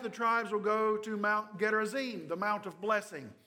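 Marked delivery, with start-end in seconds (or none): none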